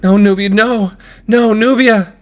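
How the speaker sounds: high, loud, desperate shout; pleading yell at full pitch; sister crying out. Acted. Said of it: "You can just hear her voice".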